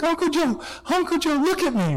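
put-on high, eager child's voice; excited little kid. Nieces and nephews clamoring at their uncle on the couch, excited. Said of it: "they come up to me, and they're like".

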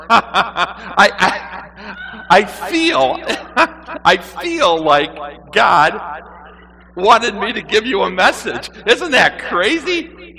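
high, loud, hammed-up giggling fit; nervous laughter. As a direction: force words out through nervous laughs. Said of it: "When I'm nervous, I laugh".

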